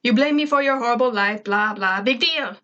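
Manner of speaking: fast, scoffing brush-off; dismissive exclamation. A narrator's reading. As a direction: rattle it off like nothing matters. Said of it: "Zim exclaimed".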